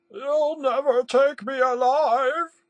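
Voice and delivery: slow, very high, loud yell; defiant yell. A narrator's reading. The intern defies Green's threat of police, defiant yell. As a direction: draw out a hammed-up shout of defiance. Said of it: "Bernal yelled".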